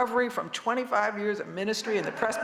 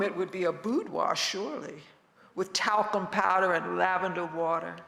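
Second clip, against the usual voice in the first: calm gentle wise voice. Acted. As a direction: savor each image gently. Poetic wisdom litany.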